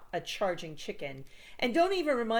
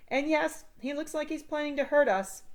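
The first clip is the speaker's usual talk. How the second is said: put-on higher, wary kid voice; 8 semitones higher, 4 dB louder than usual. A narrator's reading. Sam warns about chicken.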